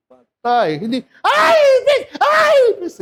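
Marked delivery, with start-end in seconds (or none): 1.3-3.0 very high loud shriek at a cockroach; hammed-up scream of fear at a cockroach; panicked shriek. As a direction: scream in mock terror at a bug. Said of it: "Hindi gano'n".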